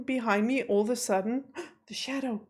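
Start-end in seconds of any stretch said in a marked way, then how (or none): 1.5-2.4 gasp, then very soft words; hushed alarm after a sharp intake; startled hush. Real, not acted. Startled by a shadow behind.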